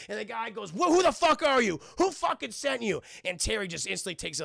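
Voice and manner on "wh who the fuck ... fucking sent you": raised, higher accusing voice; acted hostile interrogation; angry demand. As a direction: confront the intruder, loud and high.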